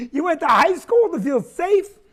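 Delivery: normal talking voice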